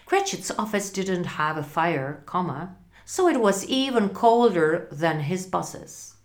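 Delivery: ordinary delivery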